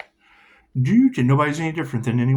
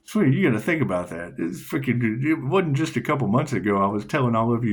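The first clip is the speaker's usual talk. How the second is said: usual speaking voice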